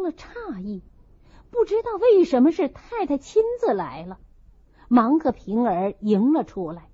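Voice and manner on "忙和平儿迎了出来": ordinary delivery, nothing marked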